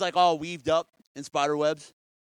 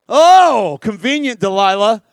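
loud mock-indignant blast; sarcastic outrage; 12 dB louder, slower than usual. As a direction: boom the accusation at her. Samson wakes tied up, accuses.

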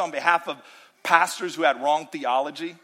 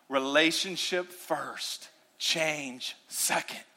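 much softer, lower earnest preaching; quiet heartfelt sermon voice; intimate preaching; 5 semitones lower, 7 dB quieter than usual. Acted. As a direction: draw them in with a hush.

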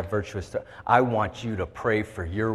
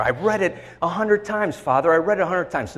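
high-pitched whiny protest to Father; retold protest; 8 semitones higher than usual. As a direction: plead it up high like an indignant child.

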